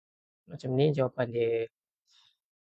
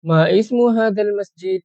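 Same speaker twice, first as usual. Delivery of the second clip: modelled loudly; 5 semitones higher, 7 dB louder than usual. Shown as a demonstration. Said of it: "teacher models question".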